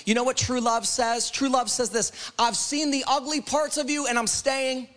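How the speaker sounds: urgent, driving sermon delivery; fervent preaching. Real, not acted. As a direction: press each promise home to the congregation. Preaches God's love.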